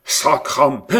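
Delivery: loud joyful German oath; German overjoyed, swearing; juron joyeux. A narrator's reading. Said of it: "s'écria l'Allemand, au comble de la joie". (juron allemand éclatant de joie)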